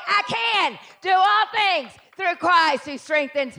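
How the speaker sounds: loud, slow declaration; slow, loud scripture proclamation; proclaimed scripture. Real, not acted. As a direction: proclaim it slowly and boldly. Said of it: "Say it".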